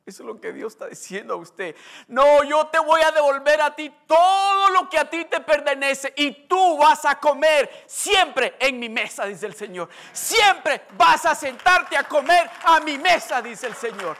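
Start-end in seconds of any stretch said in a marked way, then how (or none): 2.1-9.2 loud preacher proclaiming the promise; preaching at full force. Acted. 10.1-13.4 loud preacher proclaiming the promise; preaching at full force. Acted.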